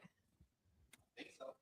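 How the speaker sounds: soft whispered aside. Real, not acted. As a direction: whisper it to yourself. Unvoiced aside.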